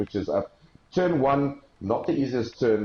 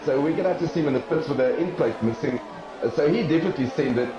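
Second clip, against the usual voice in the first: live play-by-play; 6 semitones higher than usual. Real, not acted. Live race commentary.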